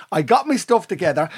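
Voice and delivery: normal talking voice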